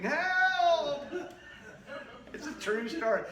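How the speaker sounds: ordinary talk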